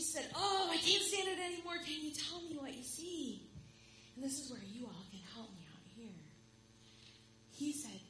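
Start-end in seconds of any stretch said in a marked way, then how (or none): 0.3-3.5 louder and higher, bursting with nerves; can't wait any longer, burst out; girl begging the stranger to look for her; anxious outburst. Acted. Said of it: "She could hardly stand it".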